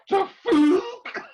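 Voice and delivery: The fuck? laughed out loud and high; laughing disbelief. Real, not acted. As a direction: laugh it out at yourself. Laughing at his own mistake.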